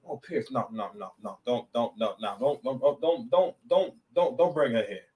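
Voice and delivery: low-key pleading, repeated don'ts; softly head him off, no after no; imagined friend's warning. Acted.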